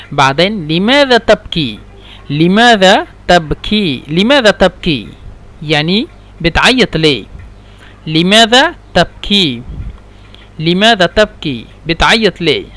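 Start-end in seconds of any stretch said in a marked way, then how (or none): 2.3-4.0 drawn out, word by word; slow teaching model. Shown as a demonstration. Teacher modelling a question for learners, slow and separated. 8.1-9.6 stretched vowels, words set apart; slow teaching model. Shown as a demonstration. Teacher repeating a question for learners, slow and separated.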